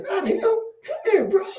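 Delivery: high, laughing "come here"; laughing callout. Retold or imitated. Teller calling the boy over, laughing and high.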